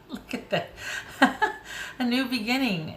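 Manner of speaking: high, breathy-free laughter over the words; overjoyed laughing words; emotional laughing. Real, not acted. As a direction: laugh through happy tears at the cards. Tearful joy at tarot spread.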